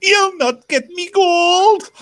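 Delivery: high, loud, drawn-out wail; Private Fraser. Acted. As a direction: wail it slowly, stretching every word. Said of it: "in the voice of, uh, Private Fraser".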